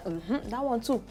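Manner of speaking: normal talking voice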